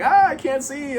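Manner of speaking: high, thin mock cry of a blinded hero; mock blinded Steve. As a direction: whine it up high like a hammed-up victim. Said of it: "So now Steve is like".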